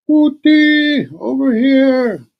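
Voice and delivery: very high, slow call from below; old man calling. Acted. Old man under the blankets calling her over. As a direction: stretch it out, help me.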